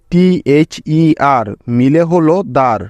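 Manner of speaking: teacher showing how টিএইচইআর becomes দার; slow spelling demo. Shown as a demonstration. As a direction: spell out টিএইচইআর slowly, then land on দার. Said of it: "সেখানেই তাকে উচ্চারণ করবে দার, এভাবে".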